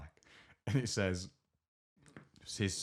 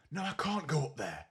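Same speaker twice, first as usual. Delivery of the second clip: higher-pitched exasperated retelling; aggravated; 6 semitones higher than usual. Retold or imitated. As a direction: mimic a woman fed up on the phone.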